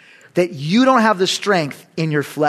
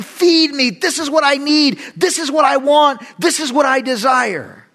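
the flesh demanding; 7 semitones higher than usual. Acted. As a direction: shout cravings like a spoiled child. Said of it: "Your flesh is saying".

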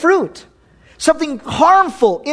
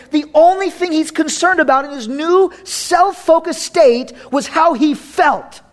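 ordinary talk

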